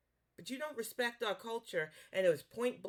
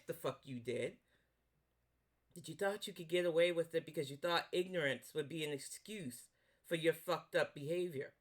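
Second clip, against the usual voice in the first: usual speaking voice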